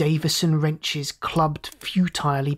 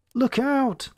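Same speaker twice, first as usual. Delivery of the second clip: high, urgent call of alarm; snapped warning; 8 semitones higher than usual. A narrator's reading. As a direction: snap out a sudden warning. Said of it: "Scott snapped".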